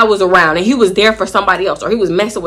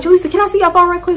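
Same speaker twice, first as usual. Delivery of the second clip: high quoted friend; 9 semitones higher, faster than usual. Retold or imitated. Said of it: "she like".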